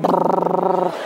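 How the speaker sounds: slow rolling brrr like an engine; engine rumble imitation. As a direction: rumble it out like a starting motor. Engine turning over sound.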